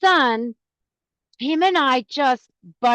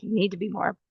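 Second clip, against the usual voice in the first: mom's advice; 6 semitones lower than usual. Retold or imitated. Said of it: "I could just hear her just like".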